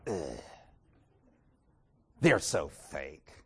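low, soft, slow sneer; scornful huff. Retold or imitated. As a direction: roll your eyes as you say it. Eye roll and huff after.

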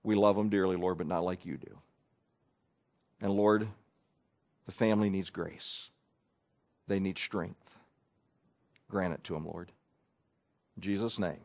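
low, soft, hushed prayer; a whispered-close prayer for the family; quiet prayer. Shown as a demonstration. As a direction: pray gently into their ear. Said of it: "the prayer has been very simple".

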